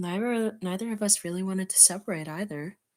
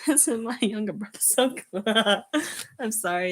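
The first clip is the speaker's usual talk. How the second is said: plain reading voice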